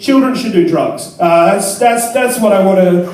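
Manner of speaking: ordinary talk, nothing marked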